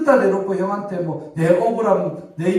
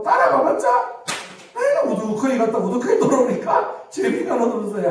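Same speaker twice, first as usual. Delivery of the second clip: put-on child's complaint; 6 semitones higher than usual. Pastor retelling why Hanna won't tag along, mock complaint. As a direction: act out an exasperated kid complaining.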